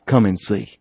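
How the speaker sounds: soft and low; a quiet, inviting call; gentle invitation. Acted. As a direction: draw them in softly.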